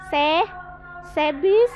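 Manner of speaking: ordinary talk, nothing marked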